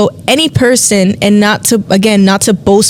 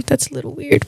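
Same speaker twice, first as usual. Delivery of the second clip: softer muttered inner thought; quiet aside; 5 semitones higher, 9 dB quieter, faster than usual. Retold or imitated. Thinking to herself about the compliments, softer aside. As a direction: say it to yourself under your breath.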